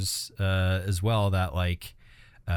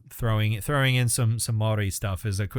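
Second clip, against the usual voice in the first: ordinary talk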